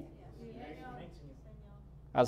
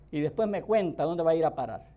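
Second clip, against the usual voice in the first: usual speaking voice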